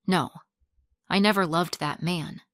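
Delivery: ordinary delivery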